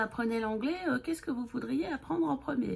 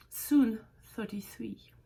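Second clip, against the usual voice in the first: slow whispered joke; sly slow whispered aside; slower than usual. Real, not acted. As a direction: whisper the fib slowly. Joking aside about her age.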